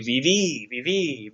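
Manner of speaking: viví with a long, stressed final í; final-syllable stress. Shown as a demonstration. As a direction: lean on the last í of viví. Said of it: "with an accent".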